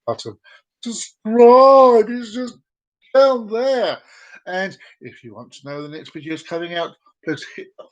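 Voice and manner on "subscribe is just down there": slow, high and loud pointer; a loud, drawn-out nudge; emphatic. Pretend. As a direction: lean into the call to action. Pointing to subscribe.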